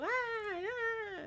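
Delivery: high wailing cry; imitating a homeless woman wailing; mock yelling. Neighbor imitates the homeless lady's yelling, high wailing cry. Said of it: "she's, like, yelling".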